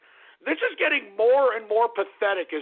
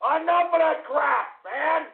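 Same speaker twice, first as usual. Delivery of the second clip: angry yelling; 8 semitones higher than usual. Real, not acted. Host ranting about body pillows.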